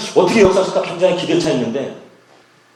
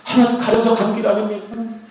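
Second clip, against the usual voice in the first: weeping plea; 9 semitones higher than usual. Retold or imitated. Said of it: "하면서 울면서".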